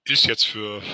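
usual speaking voice

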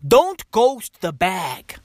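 slow preachy bro warning; slow emphatic warning. Acted. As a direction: drag out Don't ghost the bag.

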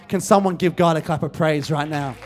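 normal talking voice

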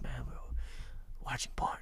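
whispered secret. Retold or imitated. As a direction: hush it so nobody hears.

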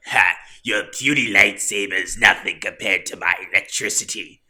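loud Sith lord taunt; gloating villain. Retold or imitated. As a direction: sneer it like a boastful evil emperor. Acting gloating Palpatine against granddaughter.

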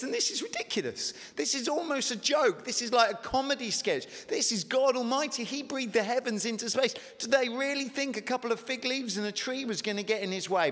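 high, mocking disbelief at the absurdity; scoffing preacher pointing out a joke; incredulous. Preacher mocking Adam hiding behind trees.

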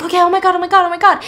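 high, loud panicked babble. Retold or imitated. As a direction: gasp it out in panic. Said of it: "they're like".

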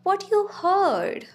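high, loud and slow wail. A narrator's reading. Bella to Edward, mortified, wailing. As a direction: stretch it out in dismay. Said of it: "I wailed".

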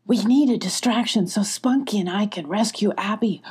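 ordinary delivery, nothing marked

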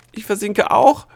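very high, loud cry of alarm; frantic yell while sinking; panicked shout. A narrator's reading. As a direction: shout back in rising panic. Gnome sinking, shouts back.

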